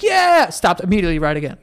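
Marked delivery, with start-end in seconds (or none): 0.0-0.5 mock cheer. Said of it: "everyone's like".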